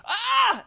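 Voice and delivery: high startled yelp; mock scream. Acting out the near miss, a high yelp. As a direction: let out a sharp fright cry.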